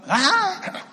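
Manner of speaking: high, loud horror-movie scream; movie shriek. Retold or imitated. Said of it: "It was like a shriek, like in the movies".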